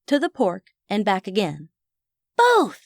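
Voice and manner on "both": put-on high, eager boy's exclamation; high-pitched triumphant decision; excited announcement. A narrator's reading. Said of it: "he announced".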